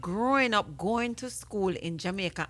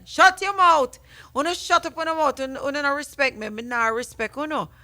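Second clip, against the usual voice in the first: loud, high mock scolding; frustrated teacher imitation; 6 semitones higher, 6 dB louder than usual. Host quotes substitute teacher telling off a child, frustrated. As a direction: imitate a teacher snapping at the class, raised pitch. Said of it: "hear the frustration in her voice".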